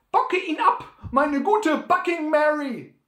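viel höher und lauter gerufen; Befehlsruf. Acted. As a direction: dem Maultier laut das Kommando geben. Sam ruft dem Maultier zu. (Sam ruft seinem Maultier laut den Befehl zu)

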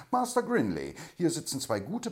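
high, loud call to Grinley; venting outburst. A narrator's reading. The banker blurting out his news to Grinley. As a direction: let it all burst out. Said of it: "machte seinem Herzen Luft".

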